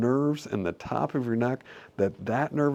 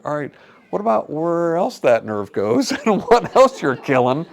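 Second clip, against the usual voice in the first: louder, higher mock disbelief with a laugh; incredulous; 6 semitones higher, 10 dB louder than usual. Answering doctors who burn nerves, loud and incredulous. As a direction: call out the absurd plan, laughing. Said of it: "I'm like".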